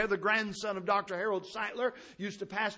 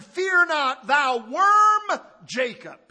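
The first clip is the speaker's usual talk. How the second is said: much higher and louder, slow booming call; God's voice; 8 semitones higher, 13 dB louder, much slower than usual. Acted. Preacher voices God calling to Jacob, booming shout. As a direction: proclaim it like a voice from heaven.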